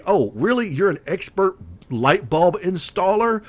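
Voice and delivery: high-pitched sarcastic questioning; mock incredulity. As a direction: act amazed at a ridiculous claim to expertise. Mocks an imagined expert installer.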